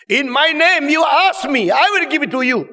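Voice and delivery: high, loud preacher's mimicry; mock-proclaiming at high volume; loud proclamation. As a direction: trumpet it out like an overblown preacher. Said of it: "itu yang digembarkan keras-keras".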